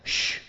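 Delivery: soft, breathy shushing sound; Jesus hushing the sea, a soft shh. Shown as a demonstration. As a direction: hush it like quieting a dog. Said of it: "Y calla es".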